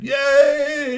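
high loud cheer; a granny's delighted whoop. A narrator's reading. Granny cheering her great-granddaughter, high loud yay.

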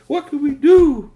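high, loud, drawn-out plea; slow, high cry of dismay; despairing wail. Acted. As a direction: wail it helplessly like a child. Child despairing over broken toy.

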